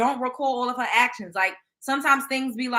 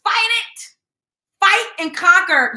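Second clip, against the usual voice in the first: higher, louder rallying cry; urgent shout; 7 semitones higher, 8 dB louder, slower than usual. Real, not acted. Urging the star to beat her demons, shouted. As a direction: shout it like a coach.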